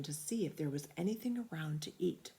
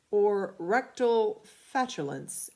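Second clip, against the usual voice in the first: slow, louder, learned tone; pompous vet; 4 semitones higher, 6 dB louder, slower than usual. A narrator's reading. Vet explaining the medical term, slow and pompous. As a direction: pronounce the jargon with importance.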